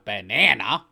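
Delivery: mocking the American banana; mock American accent. Shown as a demonstration. Australian mocking Americans, exaggerated American banana. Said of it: "We're not American".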